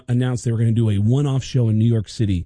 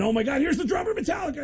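very high, fast, softer awed fan; mock starstruck crowd voice; excited fan; 14 semitones higher, 5 dB quieter, faster than usual. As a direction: gush as a buzzed fan. Drunk crowd member retold.

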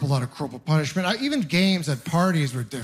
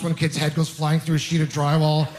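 ordinary talk, nothing marked

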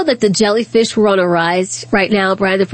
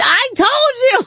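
put-on child's told-you yell; slower than usual. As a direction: yell it like a kid proven right. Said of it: "Olivia screamed".

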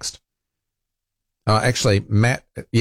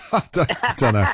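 laughing through the words; amused, laughing disbelief; 4 semitones higher than usual. Real, not acted. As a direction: chuckle out a doubtful I don't know. Host laughing at suggestion.

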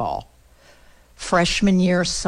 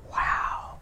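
awed whisper; 8 dB quieter than usual. Real, not acted. Speaker moved by her own story, awed whisper. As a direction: breathe out a soft, stunned wow.

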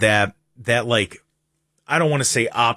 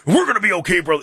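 much higher, louder hyped wrestler promo; Hulk Hogan; 8 semitones higher, 3 dB louder, faster than usual. Retold or imitated. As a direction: ham up a pumped pro-wrestler pep talk. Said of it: "Hulk Hogan would be like".

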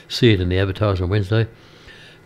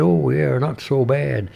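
imitated American accent, slightly raised pitch; 5 semitones higher, slower than usual. American customer answering how his day has been, imitated American accent. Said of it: "in his American accent".

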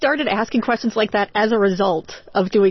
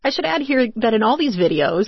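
ordinary talk

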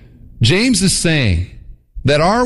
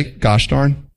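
mock prim substitute oath. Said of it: "Christians will say".

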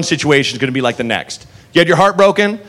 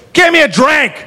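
put-on drunk shout; 10 semitones higher, 3 dB louder than usual. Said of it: "He goes".

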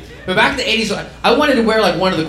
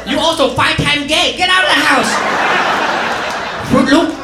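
outraged mom; 6 semitones higher than usual. Retold or imitated. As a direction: throw him out like a shocked mother. Mom reacts to son's studs.

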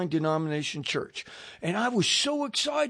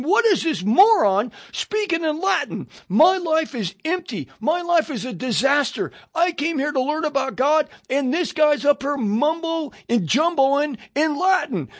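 higher-pitched retold outburst of frustration; exasperated inner rant; 5 semitones higher than usual. Newcomer silently fuming at the Latin-speaking priest, exasperated rant.

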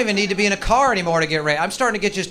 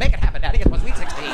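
louder bratty demand; demanding daughter; 4 semitones higher, 7 dB louder than usual. Pretend. Spoiled daughter demanding a gift from her father, louder. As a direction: insist like a spoiled brat. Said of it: "daughter demands".

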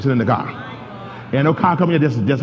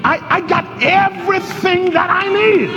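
high, shouted, sassy claim; with attitude. Shown as a demonstration. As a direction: shout it like you own it. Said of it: "say that now with your attitude".

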